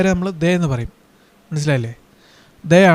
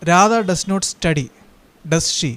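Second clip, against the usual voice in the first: slow dictation; much slower than usual. Shown as a demonstration. Teacher dictates example sentence.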